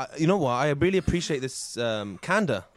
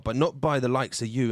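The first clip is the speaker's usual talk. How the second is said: ordinary delivery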